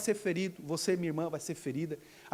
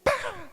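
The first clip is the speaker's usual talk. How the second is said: high-pitched exclaimed jump noise; sudden imitated bang. Preacher imitating the woman's leap after prayer, high exclamation. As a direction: pop out a high sound effect.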